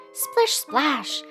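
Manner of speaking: high, playful splish splash; splashing sound words. A narrator's reading. Narrator tells of button landing in a cup, high splashing words.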